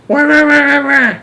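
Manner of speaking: high, loud imitated baby wail; baby bawling. A narrator's reading. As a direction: bawl "wah" like a screaming baby. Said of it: "bawling her head off".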